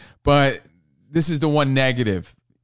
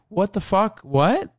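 high-pitched mock outrage; imitating a shocked friend; 8 semitones higher, slower than usual. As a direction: squeak out disbelief at the pool news. Imagined Sarah reacting in shock.

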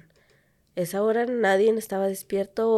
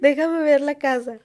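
nosy neighbor; 6 semitones higher, 4 dB louder than usual. Acted.

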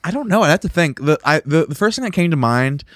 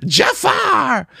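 high, shouted cartoon parrot squawk. Answers in the Aladdin parrot voice.

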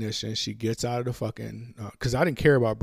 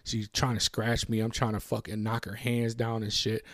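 usual speaking voice

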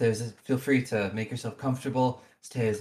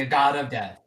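louder, firm restatement; emphatic repetition; 3 semitones higher, 6 dB louder than usual. Real, not acted. Game master repeating the warning to the player, emphatic. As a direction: drive the title home once more.

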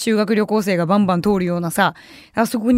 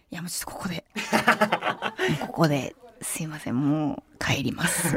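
soft, laughing apology, much quieter; quiet polite refusal; 6 semitones lower, 9 dB quieter than usual. Retold or imitated. Couple quietly excuse themselves to go home, soft and laughing. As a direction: murmur an embarrassed excuse with a chuckle.

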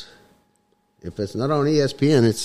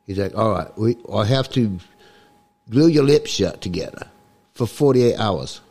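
comic mock-Cockney accent; put-on Cockney accent. Guest explains his voice to the host, put-on Cockney accent. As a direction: talk in a hammed-up Cockney accent. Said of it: "when I started talking like this".

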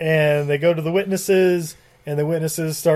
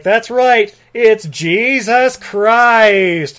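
loud, higher, hugely stretched last words; mock-grand reveal; 5 semitones higher, 5 dB louder, much slower than usual. Rabbi revealing the Messiah's name, mock-grand proclamation. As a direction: proclaim it like a big showy announcement. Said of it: "then he's like".

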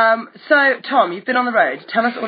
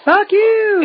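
higher, louder mock yell at a driver; mock shout; 12 semitones higher, 4 dB louder than usual. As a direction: yell it like a rude sign flashed back. Imagined banner flashed at drivers.

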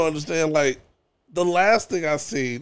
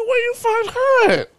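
high, drawn-out incredulous question; hammed-up astonished squawk; mock incredulity; 4 dB louder, slower than usual. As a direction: demand an answer in exaggerated high-pitched disbelief. Acts out incredulous questioning of friend.

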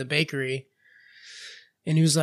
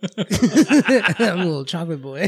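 giggling through a slow silly boast; laughing; 3 semitones higher, 3 dB louder, slower than usual. Real, not acted. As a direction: laugh while saying it. Giggling at own silly line.

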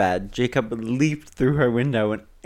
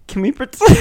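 high, loud mock shout; shouted song lyric; 12 semitones higher, 7 dB louder, faster than usual. A narrator's reading. Cartoon characters shouting at each other, mock shout. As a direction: belt it out high, mock-dramatic. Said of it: "shouting at each other".

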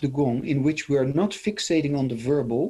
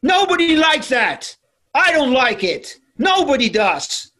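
domineering demo voice; 13 semitones higher, 8 dB louder than usual. Shown as a demonstration. Demonstrating competing voice to listener, high loud domineering. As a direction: show how ugly demanding speech sounds. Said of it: "Do you hear how horrible I sounded".

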